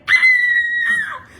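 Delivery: loud, high shriek of shock; re-enacted startled scream. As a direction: let out a sudden high, long scream. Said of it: "used to me screaming and hollering".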